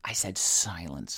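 slow, stern "silence"; mock stern. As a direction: hush them like a strict teacher. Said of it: "A moment of silence".

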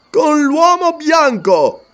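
"Con l'uomo bianco" ripetuto lento, molto più alto e forte; furore simulato. Acted. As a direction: ribatte con finta rabbia per spaventarlo. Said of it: "simulando un furore". (Umslopogaas finge furore per impressionare il Wakwafi)

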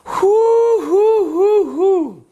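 very high, loud party whoops. Acted. Host breaks into his rant with loud high whoops.

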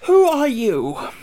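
high, loud, slow question; a straw man stretching awake; scarecrow voice. A narrator's reading. Scarecrow asks after yawning.

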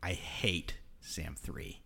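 breathless secret whisper, low and slow. Acted. Whispering to James about the annoying clone. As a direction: whisper it so the clone can't hear.

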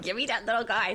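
lightly voiced grandma reaching for the baby; eager mom voice.

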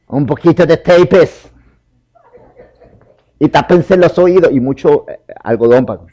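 loud and high, mocking his own naivety; laughing at past foolishness, loudly. Real, not acted. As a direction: shout it like a joke on yourself. Mock-proud preparation story, shouted.

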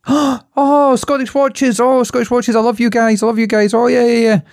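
very high, gushing fan voice; excited French lady. Retold or imitated. French lady gushing over the Scottish watch cards, very high. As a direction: squeal it like she's starstruck. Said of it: "she looks at them both and goes".

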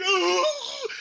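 hammed-up frightened wail; a little girl's scared yelp; mock scared cry. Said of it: "He started crying like a little girl".

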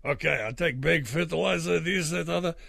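put-on customer ordering in a mild European accent. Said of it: "generic European accent".

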